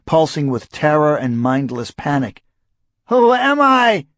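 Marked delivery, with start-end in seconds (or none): 3.1-4.1 high, loud desperate plea; terrified cry. A narrator's reading. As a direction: cry out in pure panic. Terrified man begs for identity.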